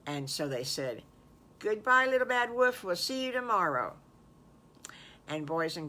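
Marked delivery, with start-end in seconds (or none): 1.6-3.9 high, louder singsong goodbye; cheery farewell. Acted. Friends bid the wolf goodbye, cheerily. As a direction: call it out to a departing friend.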